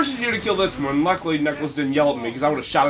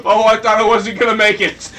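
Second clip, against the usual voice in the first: usual speaking voice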